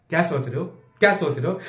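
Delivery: lightly mimicked nagging girlfriend; a hint of a probing girlfriend; mock girlfriend's small, repetitive question. Mimics girlfriend asking over and over.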